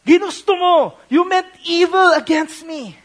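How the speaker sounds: loud, high cry of You meant evil; shouted accusation. Acted. Joseph shouting at his brothers who wronged him, loud and high.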